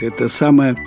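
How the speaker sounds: plain reading voice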